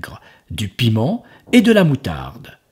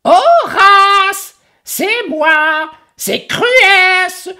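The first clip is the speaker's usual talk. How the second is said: very high, loud joyful voice; joyful ogress; 7 dB louder than usual. Acted. Ogress calling to Horace outside, very high and loud. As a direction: boom a cheery greeting through the door. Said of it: "Une voix joyeuse retentit".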